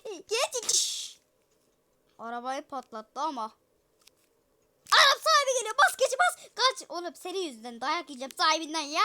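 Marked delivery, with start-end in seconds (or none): 4.9-6.9 mock panic. Panic as owner arrives.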